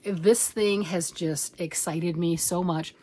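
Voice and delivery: ordinary talk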